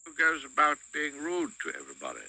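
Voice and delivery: goblin king. Acted. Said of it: "said the king".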